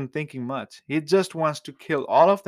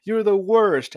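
raised, louder pouting rant; heated, higher childish shouting; mock angry outburst; 7 semitones higher, 5 dB louder than usual. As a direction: act out a hurt, angry tantrum. Acted angry outburst quote.

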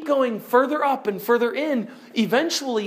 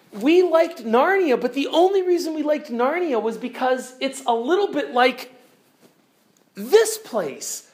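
excited; 6 semitones higher than usual. Acted. Lucy speaks in excitement.

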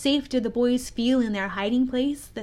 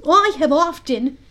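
high, loud character voice; Smee the pirate; 6 semitones higher, 8 dB louder than usual. A narrator's reading. Smee praising his captain's hook, in a high, loud sidekick voice. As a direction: chatter up high as the simple sidekick. Smee's voice in story.

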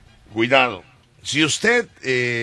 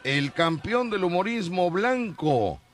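announcer voice; 7 semitones higher, slower than usual. Acted. Radio host announcing with flourish.

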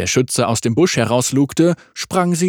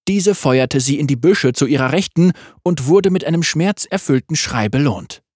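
usual speaking voice

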